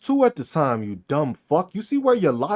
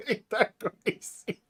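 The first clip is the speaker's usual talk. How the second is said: "Ain't that crazy?" much softer, slow, through laughter; laughing softly; 11 dB quieter, slower than usual. Real, not acted. Streamer laughs at a critic, soft and slow. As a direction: let "Ain't that crazy?" dissolve into quiet laughter.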